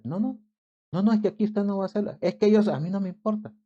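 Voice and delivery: high, fast, rattled-off telling-off; scolding guard. Retold or imitated. The security guard rushes the pushy man out of the line, scolding fast and high. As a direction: shoo him out of line at speed.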